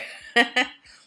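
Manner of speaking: short burst of laughter; bright laugh. Real, not acted. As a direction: laugh it off lightly. Laughs at her croaky voice.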